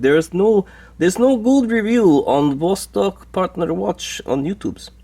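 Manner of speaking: mock a Russian caller with an overdone Russian accent; [name] in a bad Russian accent. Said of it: "that's a terrible accent".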